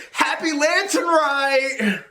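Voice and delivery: put-on high, slow, laughing girl voice; drawn out through laughter, high; man doing a woman's voice. Redone greeting laughing.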